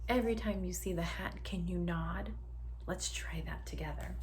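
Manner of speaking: usual speaking voice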